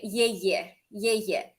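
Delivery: Е-е said short and soft, twice; Е-е, the spoken ending; ending sound demo. Shown as a demonstration. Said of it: "you would say".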